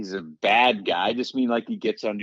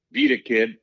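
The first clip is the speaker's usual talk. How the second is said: veteran brushing him off; 4 dB louder than usual. Retold or imitated. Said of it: "And he goes".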